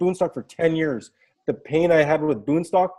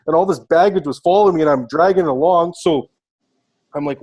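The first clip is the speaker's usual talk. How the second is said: ordinary delivery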